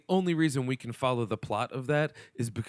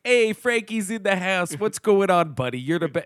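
put-on Chicago accent; 7 semitones higher, 4 dB louder than usual. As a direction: hail a buddy in thick Chicago accent. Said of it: "he's just doing Chicago".

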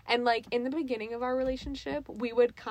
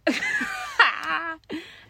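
loud laugh; 7 semitones higher, 8 dB louder than usual. Real, not acted. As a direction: burst of laughter. Laughter at sign-off.

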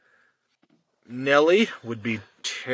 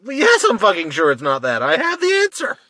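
raised, louder, exasperated retort; indignant; 8 semitones higher, 8 dB louder than usual. Real, not acted. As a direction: snap back at being doubted, pitch climbing high. Irked at being doubted.

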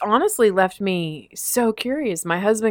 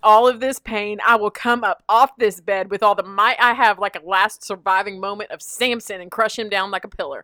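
mock fury; 3 semitones higher, 6 dB louder than usual. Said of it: "I thought".

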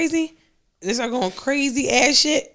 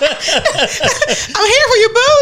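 high, very slow, laughing comfort; mock tender; 10 semitones higher, 4 dB louder, much slower than usual. Pretending to console a crying date, slow and high. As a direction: drag it out sweetly like faking support.

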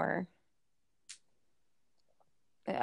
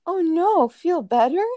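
put-on little girl voice; 9 semitones higher, 9 dB louder than usual. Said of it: "And she goes".

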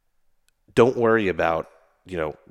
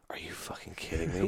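hushed, whispered disbelief; incredulous whisper; 4 semitones higher, 8 dB quieter than usual. Retold or imitated. Retelling their reaction to the endless forms, incredulous whisper. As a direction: whisper it under your breath in mock disbelief.

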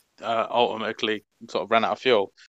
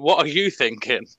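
ordinary talk, nothing marked

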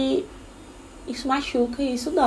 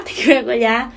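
laughing; 6 dB louder than usual. Retold or imitated. Laughing at herself.

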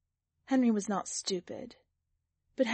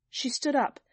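ordinary delivery

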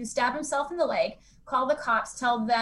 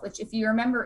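ordinary talk

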